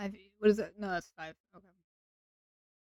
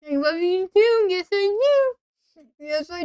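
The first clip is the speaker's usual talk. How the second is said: sugary mock love; 7 semitones higher, slower than usual.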